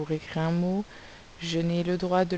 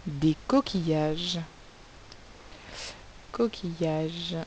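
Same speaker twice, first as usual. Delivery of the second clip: "coquillages" said slowly and softer; slow dictation; 5 dB quieter, slower than usual. Shown as a demonstration. Teacher dictating "coquillages" slowly to pupils. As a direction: stretch "coquillages" gently for spelling. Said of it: "on écrit le mot coquillage".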